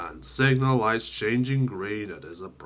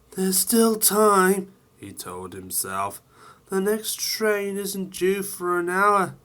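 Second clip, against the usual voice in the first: Sam's hopeful voice; 9 semitones higher than usual. Acted. Sam talking to himself.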